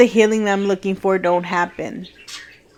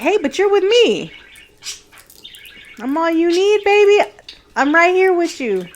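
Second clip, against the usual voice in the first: much higher, crooning, tender and doting; imitating God as a sweet talker; 10 semitones higher, 5 dB louder than usual. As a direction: play God as a lover cooing baby. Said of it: "That's not how God talks, but in my head".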